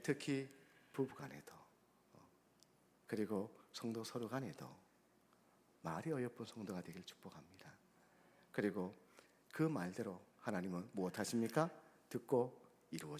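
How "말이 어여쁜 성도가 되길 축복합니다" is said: whispered blessing. Acted. Soft closing blessing.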